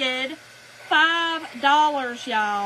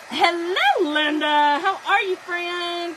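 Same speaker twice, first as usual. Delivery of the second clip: loud, higher-pitched hello; big delighted welcome; excited greeting; 4 semitones higher, 6 dB louder, slower than usual. Real, not acted. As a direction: call out to a friend joining in. Breaks off to greet a viewer.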